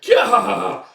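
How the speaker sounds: high, loud comic yell; jolted scream. Retold or imitated. Parachutes deploy with the pirate hanging on, jolted scream.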